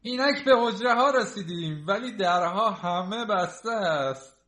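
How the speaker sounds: loud, high-pitched shout; crying out. A narrator's reading. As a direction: call it out, slowing toward the end. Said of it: "فئودور پاولویچ چنین فریاد برآورد".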